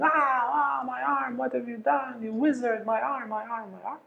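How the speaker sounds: high, wailing cries of pain about his arm; pained cry. Retold or imitated. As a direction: wail as if your arm is frozen. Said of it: "they hear somebody shouting".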